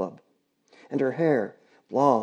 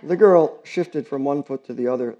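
ordinary delivery, nothing marked

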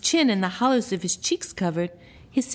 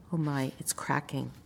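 hushed whisper; 6 semitones lower, 9 dB quieter than usual. A narrator's reading. Narrator whispering as the egg cracks. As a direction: whisper it like a secret.

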